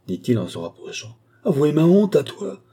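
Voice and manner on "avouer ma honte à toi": pitched-up, forceful exclamation; indignant cry. A narrator's reading. Wife answering her husband's reproach, crying out. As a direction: cry out high and loud. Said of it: "s'écria-t-elle".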